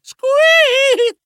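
loud high mouse squeak; shrill startled squeak. Acted. Scared mouse squeaks at partner's threat.

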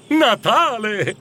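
slow, high, delighted exclamation; lit up with joy. Acted. Scrooge learning it is Christmas from the boy, elated. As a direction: draw the word out in happy wonder. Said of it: "Scrooge si illuminò".

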